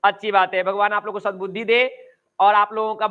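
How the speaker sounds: normal talking voice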